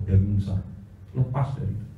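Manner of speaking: usual speaking voice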